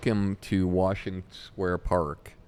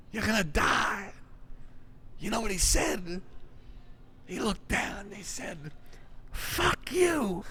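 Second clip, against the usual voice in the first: much higher raspy street-comic impression; raspy voice of Charlie; 11 semitones higher than usual. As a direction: tell the fire story like the old street comic. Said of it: "have this raspy voice".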